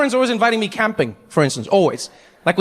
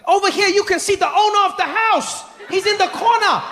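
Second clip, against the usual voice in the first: much higher, shouting guide welcome; overeager tour guide; 12 semitones higher than usual. Retold or imitated. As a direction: greet them like a way too happy guide. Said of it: "he's like".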